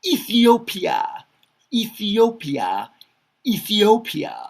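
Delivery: teacher repeating "Ethiopia" up high; careful pronunciation. Shown as a demonstration. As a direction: model "Ethiopia" slowly for the class. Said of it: "teaching country names by repetition".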